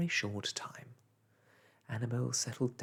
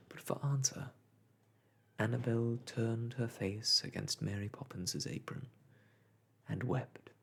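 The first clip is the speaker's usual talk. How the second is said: ordinary delivery, nothing marked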